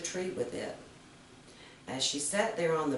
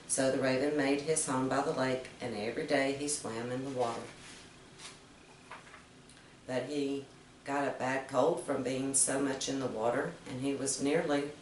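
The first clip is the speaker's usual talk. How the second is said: ordinary delivery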